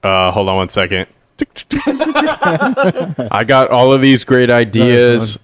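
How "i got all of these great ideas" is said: slow, laughing. Pretend. Playing the mayor, slow.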